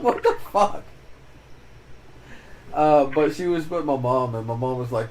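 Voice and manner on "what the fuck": higher, louder mock disbelief; incredulous. Retelling his reaction to his sister, incredulous. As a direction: spit it out in disbelief.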